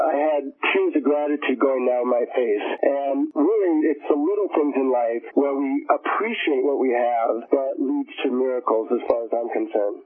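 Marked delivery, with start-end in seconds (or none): 2.8-8.9 slow, deliberate moral; slow, earnest. Real, not acted. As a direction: let the lesson land slowly. Closing moral, slowed.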